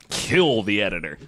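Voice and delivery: ordinary talk, nothing marked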